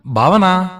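high, loud, drawn-out call. A narrator's reading. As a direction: call BHAVANA out across the house. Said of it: "అని పిలిచారు".

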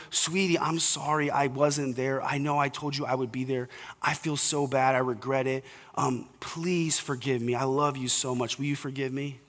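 soft, gentle father asking forgiveness; hushed sincere fatherly apology; tender apology. Shown as a demonstration. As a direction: quietly say sorry to your son. Father apologizing to daughter, demo.

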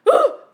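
high loud gasp of fright; sharp startled gasp. Retold or imitated. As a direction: gasp as if a spider ran up you. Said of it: "I was like".

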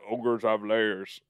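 put-on slow deep voice with a vague French-like accent; Fezzik impression. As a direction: attempt a deep, accented character voice. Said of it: "like, a deep voice".

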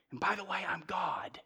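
near-whispered, confiding revelation; hushed aside. Retold or imitated. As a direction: drop to a very soft murmur. Jesus' revelation voiced as an aside.

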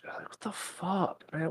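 high, soft and slow what the fuck; slow, breathy incredulous groan; exasperated disbelief. Real, not acted. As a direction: drag it out in disbelief. Outraged by the post.

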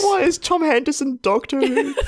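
high mocking question; teasing squeak. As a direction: squeak it up to tease him. Mocking friend's impression.

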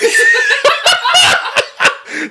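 shrieking high laugh; high squealing laugh. Real, not acted. Cracking up at the sleepy soldiers joke, high squealing laugh.

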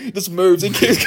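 ordinary delivery

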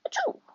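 sudden high squeaky sneeze; big sneeze. Acted. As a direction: sneeze it out like a little piglet. George sneezes, has caught cold.

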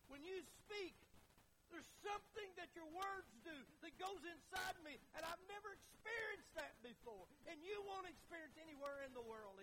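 high, impassioned pulpit delivery; fervent preaching. Acted. Preacher voicing Peter's confession to the Lord, fervently. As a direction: plead Peter's case with full conviction.

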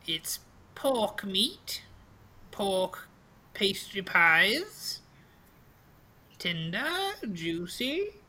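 high crone, slow and savoring; old pastry seller. Acted. Old lady describes her pies, high slow crone voice. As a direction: dole out each word enticingly, pitched high.

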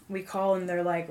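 ordinary talk, nothing marked

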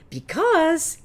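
"because" sung out high and loud; loud high take of "because"; belted because. Shown as a demonstration. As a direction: belt "because" high and full. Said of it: "I must have said it fifty times".

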